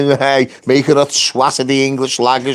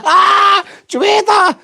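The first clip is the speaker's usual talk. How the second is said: mock surprise. Mocking the judge after his ruling, high gleeful taunt. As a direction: squeal it like you caught them out. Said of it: "I went".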